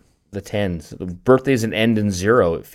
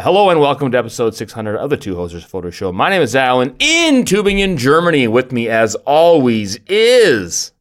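announcer intro; 3 semitones higher, 4 dB louder than usual. Shown as a demonstration. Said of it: "me saying the intro different".

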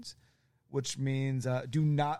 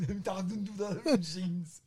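higher, put-on action-hero accent; hammed-up heroic declaration; Arnold character voice; 5 semitones higher than usual. Druid explaining they talk to machines, Arnold impression. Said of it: "in that accent for that voice".

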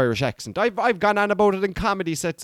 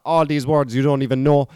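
ordinary talk

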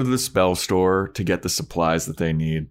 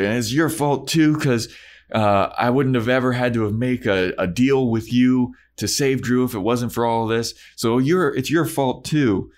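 higher, put-on Spike accent, ranting; 5 semitones higher than usual. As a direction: rant at Angel in a mock Spike accent. Spike blaming Buffy, voiced.

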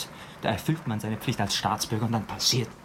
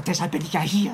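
usual speaking voice

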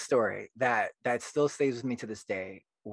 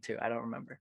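usual speaking voice

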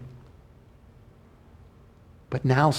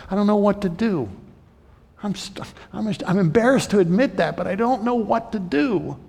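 higher, strained, small voice; a lost child's plea; pleading helplessness; 7 semitones higher than usual. Pretend. A believer confessing helplessness to God, higher and strained.

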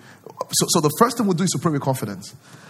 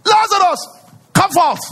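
huge high shout; Jesus calling Lazarus, booming shout; loud cry; 7 dB louder than usual. Retold or imitated. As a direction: call the dead out of the tomb. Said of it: "he cried with a loud voice".

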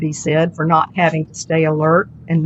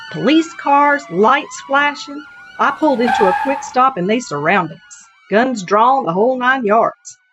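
higher, lively storyteller driver voice; chatty cab driver; 6 semitones higher than usual. Acted. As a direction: spin the yarn like a cheerful Texas cabbie. Rita continues her story.

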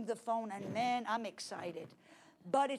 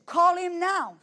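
firm command; 7 semitones higher, 12 dB louder, slower than usual. Retold or imitated. Holy Ghost commands firmly.

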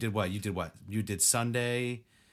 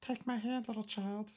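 creepy ghost voice; 11 semitones higher, 7 dB quieter than usual. Acted. Voicing a creepy Pokemon.

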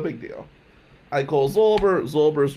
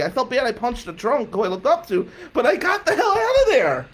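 high-pitched, insistent self-defense; frantic denial; 9 semitones higher than usual. Retold or imitated. Nephew convincing aunt and mom nothing happened, frantic denial. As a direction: protest your innocence, climbing higher.

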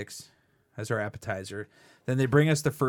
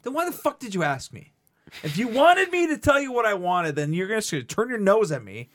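retold angry rant; 8 semitones higher, 3 dB louder than usual. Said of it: "I'm like".

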